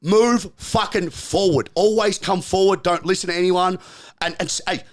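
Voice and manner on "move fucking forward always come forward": slow, deliberate rallying call; slow emphatic. Real, not acted. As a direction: drag it out word by word. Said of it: "For everyone watching".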